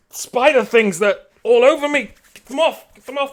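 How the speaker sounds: high, loud, frantic pleading; frantic yelling. Acted. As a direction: yell for them to get it off. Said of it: "just says".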